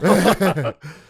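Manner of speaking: short loud laugh, overcome by feeling; emotional laugh. Real, not acted. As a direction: let a teary laugh burst out. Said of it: "I'm getting emotional now".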